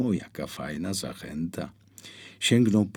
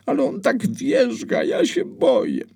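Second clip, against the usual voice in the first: put-on high, loud frightened child voice; a small boy scared of the foal; scared little boy; 7 dB louder than usual. Scared boy telling mama the foal is kicking, high and frightened.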